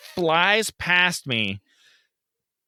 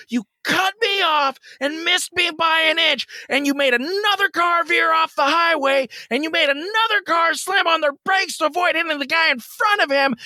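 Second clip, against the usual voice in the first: much higher furious shouting tirade; going off on her; 14 semitones higher than usual. Retold or imitated. Driver yelling at a teenage girl, furious. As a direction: unload all of it on her. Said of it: "going off on her".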